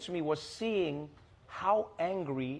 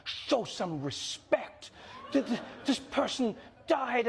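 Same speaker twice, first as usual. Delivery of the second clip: slow, much higher mocking voice of scolding mourners; 7 semitones higher, slower than usual. Guest mocks people scolding others, high mock voice. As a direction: parody people demanding respect. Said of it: "People are like".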